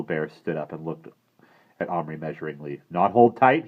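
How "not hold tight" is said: put-on loud, stern brave scolding the boy; a proud warrior unimpressed by fuss; disapproving Indian brave.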